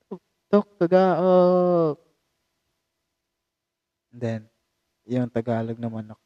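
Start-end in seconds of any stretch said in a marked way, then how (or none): 0.1-2.0 high, loud rooster crow "Tuk, tuk, tugaok"; Bisaya rooster crow. Shown as a demonstration. Vlogger imitating the Bisaya rooster's crow. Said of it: "yung sound niya is".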